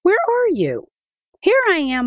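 put-on high, bright child's voice, jumping out with a surprise; excited little boy. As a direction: play the eager boy popping out to surprise Mama. Adika calls out to Mama.

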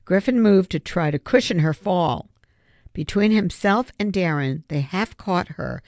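plain reading voice

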